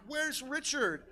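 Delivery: high-pitched, worried-sounding call; concerned question. Acted. Concerned rider questions a horse in the woods, high voice. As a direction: ask it high, as if the horse could answer.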